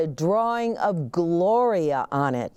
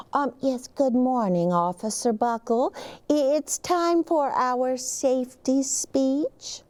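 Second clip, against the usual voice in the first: lightly higher polite caller; polite principal; 6 semitones higher than usual. Acted. The principal calls Officer Buckle, polite and higher. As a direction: ask politely on the phone. Principal phones the police station.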